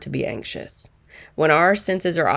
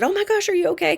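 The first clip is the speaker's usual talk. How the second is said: high-pitched startled concern; surprised concern; 14 semitones higher, much faster than usual. Retold or imitated. Technician startled to see her crying, high concern. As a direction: pitch it way up in alarm. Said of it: "And she said".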